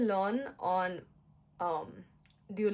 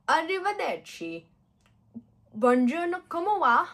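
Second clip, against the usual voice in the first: high, loud hammed-up Italian greetings; over-the-top Italian; 8 semitones higher, 7 dB louder than usual. Learner showing off Italian phrases, hammed-up and loud. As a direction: belt it like an eager tourist. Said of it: "I must be sounding like a baboon".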